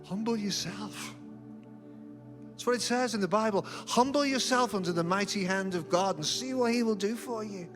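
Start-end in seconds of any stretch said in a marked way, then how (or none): none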